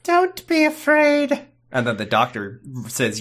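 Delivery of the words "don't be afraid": very high, drawn-out silly voice; mock falsetto. Co-host parodying Leela's reassurance, in high slow falsetto. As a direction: stretch it out in a squeaky falsetto.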